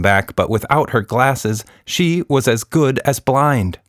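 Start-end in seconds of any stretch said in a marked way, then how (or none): none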